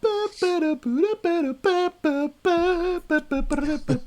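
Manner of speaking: high wordless sung tune; singing a tune. Real, not acted. Co-host sings the Chocobo song to open, high wordless singing. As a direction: belt out bouncy nonsense syllables to a game tune. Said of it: "why the hell I'm singing".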